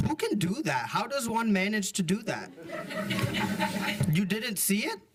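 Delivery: chuckling while imitating grandma; amused grandmother.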